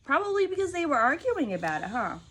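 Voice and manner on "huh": ordinary delivery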